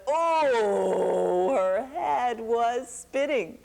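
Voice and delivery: slow, woozy and reeling; dizzy. A narrator's reading. Storyteller as a dizzy Elephant, slow and woozy. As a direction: let the head spin as you say it.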